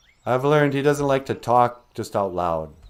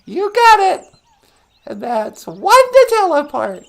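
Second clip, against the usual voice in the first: very high squeaky puppet wife greeting; 8 dB louder than usual. Acted. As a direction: chirp it in a tiny high voice.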